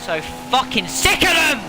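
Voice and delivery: fed-up angry rant at the game; frustrated outburst. Real, not acted. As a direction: vent at the AI ramming.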